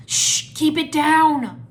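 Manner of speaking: drawn-out shush, then high, forceful warning; urgent warning. A narrator's reading. Cole shushes his rescuers near the temple, urgent warning. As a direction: hush them hard, stretching the words. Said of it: "Cole warned".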